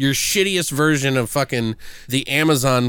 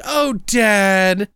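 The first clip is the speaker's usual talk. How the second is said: higher, louder hammed-up sappiness; mock touched daughter; 5 semitones higher, 3 dB louder than usual. Daughter moved by her dad's love, mock sappy. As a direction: swoon it like a cheesy movie daughter.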